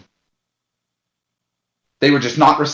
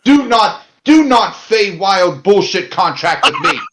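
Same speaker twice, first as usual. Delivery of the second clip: much higher and louder outraged protest; indignant protest; 9 semitones higher, 5 dB louder than usual. Pretend. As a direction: cry foul at the trickster deal. Protesting fey-style bargain.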